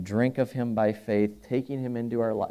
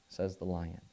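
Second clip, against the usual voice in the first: ordinary talk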